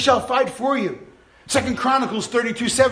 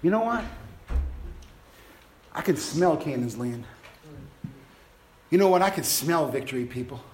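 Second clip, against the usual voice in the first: Caleb; 10 semitones lower, 6 dB quieter than usual. Retold or imitated. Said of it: "Caleb said".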